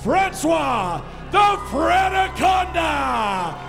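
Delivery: big booming fight-night introductions; boxing ring announcer. Acted. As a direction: stretch the names like a ring announcer.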